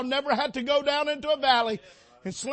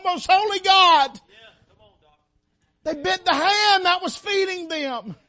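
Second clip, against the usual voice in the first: loud, high, driving sermon delivery; a preacher crying out the scripture; full-force preaching; 6 semitones higher, 10 dB louder than usual. Real, not acted. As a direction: preach it hard to the congregation.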